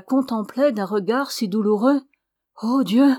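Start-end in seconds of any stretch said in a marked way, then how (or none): 2.6-3.1 higher, louder cry of dismay; alarmed cry. A narrator's reading. Ramona seeing Alessandro so changed, alarmed cry. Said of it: "cria Ramona".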